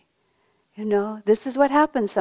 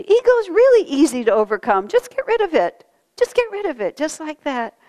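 much higher and louder, bright and breezy; cheerful; 7 semitones higher, 4 dB louder than usual. Retold or imitated. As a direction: toss it off cheerfully, like it's nothing. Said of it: "he just said so cheerfully".

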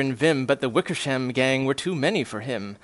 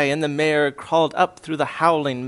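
plain reading voice

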